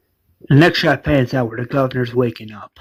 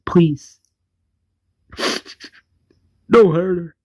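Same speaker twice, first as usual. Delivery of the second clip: begging; 5 semitones higher than usual. Retold or imitated. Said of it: "The governor begs".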